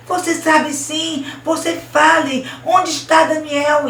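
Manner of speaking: high, loud, slow accusation; insistent demand. Acted. Girl insisting against crying boy. (cobrança insistente, aguda e forte)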